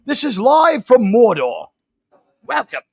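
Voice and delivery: put-on New Zealand accent, loud. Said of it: "I can't do my New Zealand accent unless I shout".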